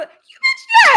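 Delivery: high, loud shriek of disbelief; shrieked you bitch. Real, not acted. Ranting to viewers, a high loud outraged shriek. Reacting to woman's question.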